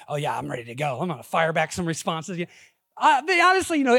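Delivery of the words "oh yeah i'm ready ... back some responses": mock debate-ready eagerness. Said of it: "the old apologetic, you know, training I have".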